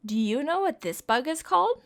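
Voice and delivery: ordinary delivery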